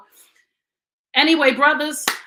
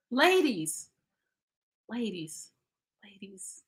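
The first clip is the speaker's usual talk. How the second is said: each "ladies" softer until it is whispered; fading to a whisper; 3 semitones lower, 5 dB quieter, slower than usual. Acted. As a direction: drop down to a secret whisper. Fades down to a whisper.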